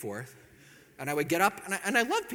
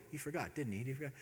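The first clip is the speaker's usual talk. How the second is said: hushed suspicion; 3 semitones lower, 10 dB quieter, faster than usual. Retold or imitated. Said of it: "I could tell she's wondering".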